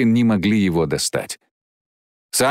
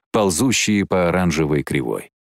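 ordinary delivery

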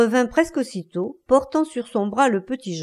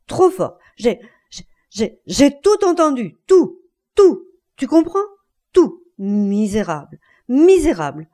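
stammering rage; 2 semitones higher, 6 dB louder than usual. Acted. Betrayed husband throws out wife and lover, stammering with fury. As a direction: choke on repeated words, then shout them out. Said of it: "Et bégayant".

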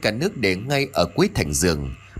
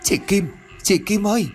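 high frightened calling out of a name; terrified cry; 9 semitones higher than usual. A narrator's reading. Yến screaming for Kim, terrified and high. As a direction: cry out in terror for her sister. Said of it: "Yến kinh hoàng hét lên".

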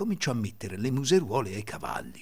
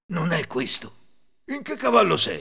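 loud, raised, impatient question; calling out; 7 semitones higher, 6 dB louder than usual. A narrator's reading. Thieves hunting for Cecino among horses, loud calling. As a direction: call out to someone out of sight.

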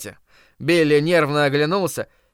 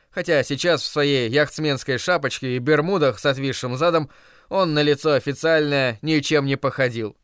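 ordinary delivery, nothing marked

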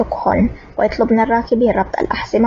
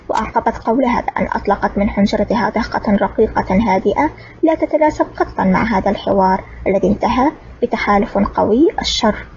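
usual speaking voice

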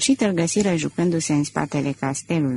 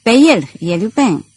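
louder, higher call to seize him; shouting; 4 semitones higher, 6 dB louder than usual. Acted. Detective shouting to the servants to seize Lupin, a mock yell. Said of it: "se repezise spre el strigând".

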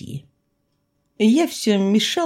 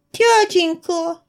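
put-on high, slow wheedling child voice; wheedling girl; 12 semitones higher, much slower than usual. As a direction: drag out the plea like a pestering girl. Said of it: "клянчила маленькая светленькая волчица".

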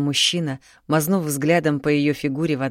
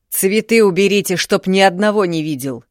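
яростный крик; 5 semitones higher, 7 dB louder than usual. A narrator's reading. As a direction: shout it out, furious and loud. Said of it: "проорал вглубь дома". (громкий яростный крик)

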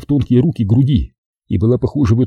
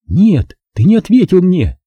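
put-on higher girlish voice, pushing back; stubborn young woman, lifted voice; insistent girl; 8 semitones higher than usual. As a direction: Zaza refuses to move, high insisting voice. Zaza insists during shelling.

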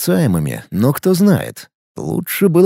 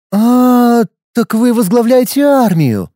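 high, louder sudden realization; the penny drops, pitched up; realizing woman; 9 semitones higher, 3 dB louder, slower than usual. Acted. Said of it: "догадалась она".

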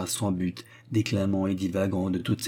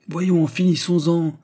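impatient steward; 8 semitones higher, 5 dB louder, slower than usual. A narrator's reading. Steward losing patience.

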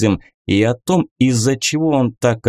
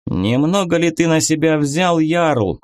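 higher called-out taunt; mock shout; 6 semitones higher than usual. A narrator's reading. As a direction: call it after him, higher. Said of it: "крикнул в спину Буревой".

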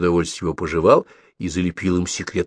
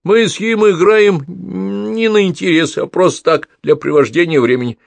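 shameless; 12 semitones higher, 6 dB louder than usual. A narrator's reading. Gavrila brazenly swears to the young master, high and loud. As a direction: swear it brazenly like a sly servant. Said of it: "прибавил он бесстыдно".

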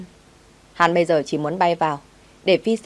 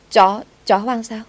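higher, louder, slow shocked gasp; 5 semitones higher, 5 dB louder, slower than usual. Acted. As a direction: recoil in disbelief. Nanny shocked at stray dog.

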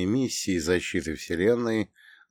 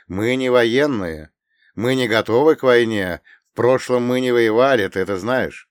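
captain's voice; 3 semitones higher, 7 dB louder than usual. Acted. Captain objects.

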